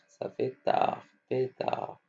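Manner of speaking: whispered sound-out; whispered sounding out of pétard. Shown as a demonstration. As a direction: whisper the syllables to the class. Teacher sounding out word softly.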